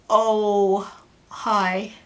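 awkward, deflated phone hello; unenthusiastic greeting. Retold or imitated. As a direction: greet an unwelcome caller without any enthusiasm. Unimpressed reply to ex on phone.

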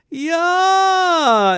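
high drawn-out scream; long scream. A narrator's reading. Whole family as the latke flies, screamed. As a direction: shriek at a flying disaster. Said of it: "everyone screamed".

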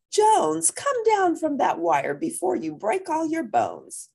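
high worried call to the wire. Acted. Mother calling her son down off the wire, high and worried. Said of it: "Mama calls to son on wire".

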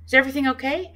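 awkward daughter stiffly asking her father. A narrator's reading. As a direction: ask it stiffly, keeping your distance. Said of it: "I asked stiffly".

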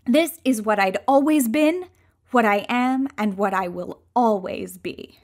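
plain reading voice

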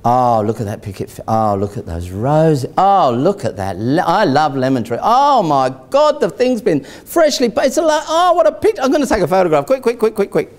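breathless, swooning house buyer; gushing excitement. Retold or imitated. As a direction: gush over every detail, giddy.

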